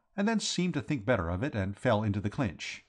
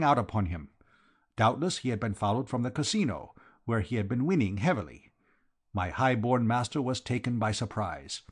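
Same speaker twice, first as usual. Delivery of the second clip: foreign Count with v for w; Count, dramatic recounting; 4 semitones higher, 3 dB louder than usual. Acted. Count recounting his master's rescue to the detective, dramatic. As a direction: tell the tale with courtly fervour.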